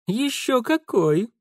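high, slow, self-satisfied drawl; smug boast. Acted. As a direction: revel in the compliment. Dandy boasts in agreement. (самодовольное хвастовство)

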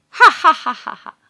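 loud high witch cackle that drops off; a wicked, frightening witch laugh; evil cackle. Acted. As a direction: cackle menacingly over the cradle. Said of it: "râde înfricoșător".

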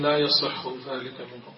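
normal talking voice

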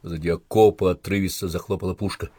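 ordinary delivery

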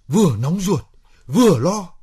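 worked-up, high and loud; agitated venting. Acted. Man venting to partner about waiting, agitated.

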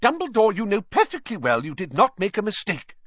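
high, loud angry Scottish teacher; angrily. A narrator's reading. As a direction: scold with heat. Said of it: "said Professor McGonagall angrily".

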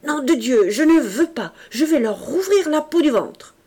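a madman's enraged shout; raging threat. A narrator's reading. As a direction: spit out the threat with a knife in hand. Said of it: "il répétait".

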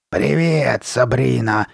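raspy, stretched monster greeting; growling. A narrator's reading. Masked girl greeting her friend, growling. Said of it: "прорычала Карли Бет".

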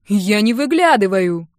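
loud, slow, emphatic denial; riled denial. A narrator's reading. Uncle teased as a nosy old man, riled. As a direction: deny it loudly, stung by the teasing. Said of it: "мигом завёлся он".

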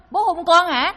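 hammed-up loud confusion; mock baffled question. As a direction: imitate a loud, high, incredulous question. Son mishears dying father's request.

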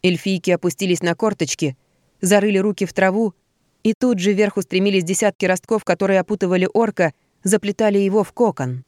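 ordinary delivery, nothing marked

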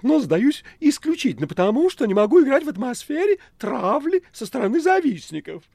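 very high, loud cat voice; affronted talking cat, high-pitched; huffy cat Behemoth. Acted. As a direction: squeal the surrender with offended pride. Cat excuses his defeat.

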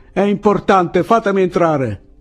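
high, loud plea to get through; urgent yell. A narrator's reading. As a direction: shout to be let in. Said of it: "urlò Andrés".

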